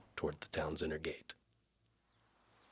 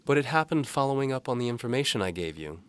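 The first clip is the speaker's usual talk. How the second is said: higher, louder lightly voiced young woman; Galena's voice; 9 semitones higher, 7 dB louder than usual. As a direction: voice the maid brightly and politely.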